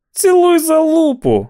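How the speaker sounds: jeer, then shriek the last insult; angry yell. A narrator's reading. Barkovitch snapping back at the walker who calls him killer, angry yell. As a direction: taunt building to a high, loud screech. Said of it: "гаркнув Баркович".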